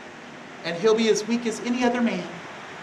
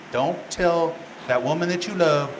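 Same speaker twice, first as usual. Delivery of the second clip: usual speaking voice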